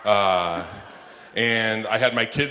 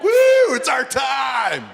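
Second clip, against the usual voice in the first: hammed-up crowd cheering, much higher and louder; mock excited cheer; 12 semitones higher, 3 dB louder than usual. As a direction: play sad people suddenly thrilled. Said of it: "They're like".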